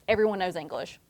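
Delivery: ordinary talk, nothing marked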